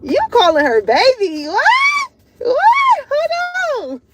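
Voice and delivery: very high squealing what; shocked teasing. Retold or imitated. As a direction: gasp in mock shock. Friends teasing about pet names.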